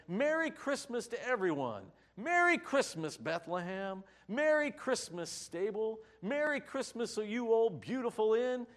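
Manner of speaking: high, loud mock yell of festive greetings; exuberant yelling. Shepherds running through Bethlehem greeting everyone, exuberant yelling. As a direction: call it out high and joyful, like George Bailey running through town.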